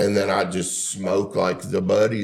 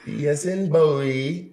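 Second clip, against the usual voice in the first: higher, slow, laughing customer service voice; mock entitled caller spelling it out; entitled customer impression; 9 semitones higher, much slower than usual. Shown as a demonstration. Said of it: "my talking back to customer service voice".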